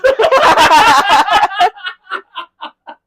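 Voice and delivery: long, loud, high-pitched laugh; burst of laughter. Real, not acted. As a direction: crack up loudly at the joke. Joking about being banned.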